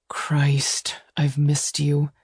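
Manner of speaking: whispered, aching groan. A narrator's reading. Lover groaning to her after long nights apart, whispered. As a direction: let the longing out in a whisper. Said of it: "he groaned".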